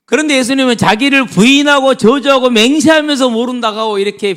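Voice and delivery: loud, high, impassioned sermon; forceful preaching. Real, not acted. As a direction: hammer it home to the congregation.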